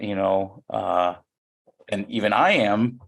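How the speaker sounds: ordinary talk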